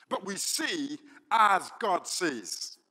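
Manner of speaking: slow, stressed as God sees; preacher driving home the point, slow; preaching emphasis. Acted. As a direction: lean hard on as God sees. Preaching climax.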